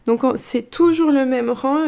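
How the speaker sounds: ordinary talk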